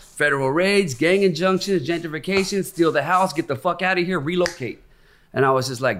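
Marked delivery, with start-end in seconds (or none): 0.0-4.8 higher, heated retold outrage; indignant rant. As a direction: rail against being pushed out. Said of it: "I'm like".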